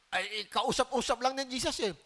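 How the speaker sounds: ordinary talk, nothing marked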